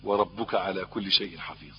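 slow, chanted Quranic recitation. Acted. Preacher reciting the verse to the congregation, slow chant. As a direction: intone the verse slowly.

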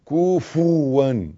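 كفوا chanted slowly with u on kaf and fa; double damma. Shown as a demonstration. Teacher correcting the sura, slow كفوا as ku-fu-wan. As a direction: draw out both u vowels in كفوا. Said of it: "الكاف عليها ضمة والفه عليها ضمة".

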